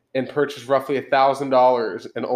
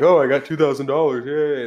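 lightly hammed-up glee, higher and louder; mock excitement; 4 semitones higher, 5 dB louder than usual. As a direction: play the naive new cardholder cheering. Acting out getting a new credit card.

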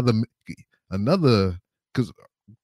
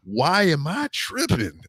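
disbelieving; much slower than usual. Retold or imitated. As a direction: throw her question back, stunned. Repeating her question in disbelief.

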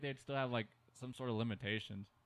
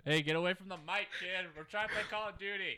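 higher, pushy voice shooing someone off the mic; annoyed gamer kid; 7 semitones higher than usual. Pretend. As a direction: snap at him to get off the mic. Kid voiced playing Call of Duty.

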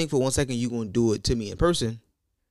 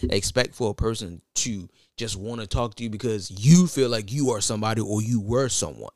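ordinary delivery

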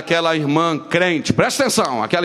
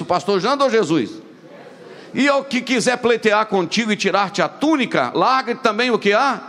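preaching at full force; 6 semitones higher than usual. Acted. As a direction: proclaim each line to the church. Preaching the verses.

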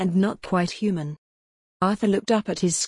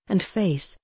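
usual speaking voice

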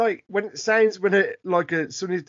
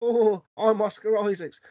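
real bad Cockney accent; Oscar Isaac. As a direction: do a deliberately terrible Cockney. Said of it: "real bad Cockney accent".

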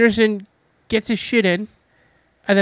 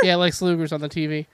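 ordinary talk, nothing marked

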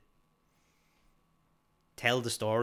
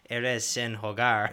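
mock Spanish; 5 dB louder, much slower than usual. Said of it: "He's like".

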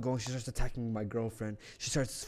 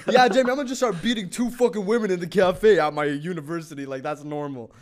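loud, high-pitched mock confession; sarcastic; 5 semitones higher, 8 dB louder than usual. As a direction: protest with heavy sarcasm, starting high and loud. Sarcastic retort to Jamie's question.

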